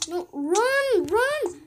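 high, loud panicked yelling; real panic, crying out as you die; panicked shout. Real, not acted. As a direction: scream for your character to escape. Getting killed in game.

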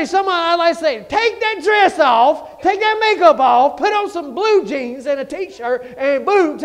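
loud, high preacher's thunder; full-force preaching. Acted. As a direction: hammer it at the congregation.

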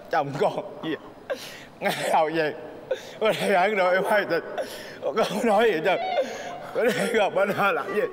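words breaking into laughter; can't keep a straight face; laughing while talking. Real, not acted. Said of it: "tao kể chuyện buồn mà mày vui quá vậy". (vừa nói vừa cười)

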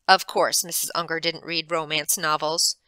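plain reading voice

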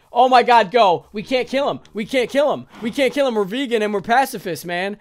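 loud, high panicked yells; frantic yelling. Real, not acted. Gamer trapped with a zombie, yelling in panic. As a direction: yell it over and over in a panic. Zombie inside, can't fight.

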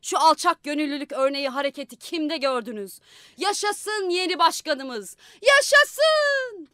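building to a high, loud cheer; rousing cheer. Acted. Freckled man rallies the crowd for the mayor, building to a shout. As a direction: rouse the crowd, rising to a cry.

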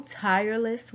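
usual speaking voice

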